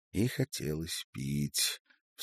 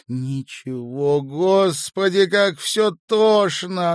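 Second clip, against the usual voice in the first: loud, high, drawn-out anguished wail; crying; 13 semitones higher, 13 dB louder, slower than usual. A narrator's reading. Woman overwhelmed, answering worried man, crying. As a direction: wail it out slowly, breaking into tears. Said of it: "Она снова заплакала".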